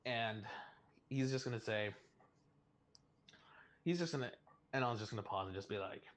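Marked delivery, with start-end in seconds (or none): none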